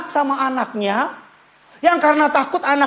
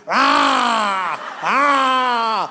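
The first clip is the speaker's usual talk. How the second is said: put-on child crying louder; 2 semitones higher than usual. Son's tantrum imitated by father, mock crying yell. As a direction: cry like a toddler throwing a fit.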